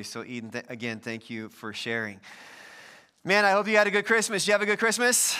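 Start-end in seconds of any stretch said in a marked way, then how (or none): 3.2-5.4 upbeat greeting. Real, not acted. Pastor greets church after Christmas.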